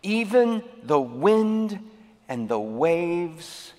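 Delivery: dramatic scripture reading. A narrator's reading. Pastor reads the storm story to the congregation, dramatic scripture reading. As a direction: read scripture with long pauses and weight.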